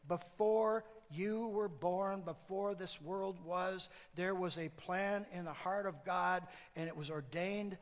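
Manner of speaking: slow, weighty delivery; slow solemn preaching. Real, not acted. Preacher slowing down for a solemn truth. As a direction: lay each phrase down slowly.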